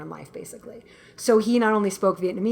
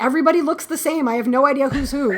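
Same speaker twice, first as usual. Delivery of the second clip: mock mom voice, higher and faster; higher, quick mom impression; imitating mom; 5 semitones higher, 5 dB louder, faster than usual. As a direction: play the confused mom complaining.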